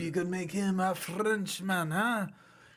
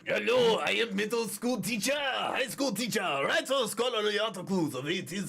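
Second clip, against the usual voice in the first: hammed-up mixed European accent, higher; a jumble of French, Italian and Russian accents, pitched up; mixed accent Hugo; 3 semitones higher than usual. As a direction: play Hugo as a Frenchman who keeps slipping into other accents. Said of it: "give us your interpretation of Hugo".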